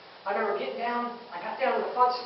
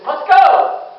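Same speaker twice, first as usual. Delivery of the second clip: high, loud call to move in; rally them through the door; shouted go; 9 semitones higher, 15 dB louder than usual. Retold or imitated. Senior man sending the crew in, loud shout.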